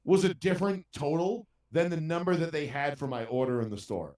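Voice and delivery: usual speaking voice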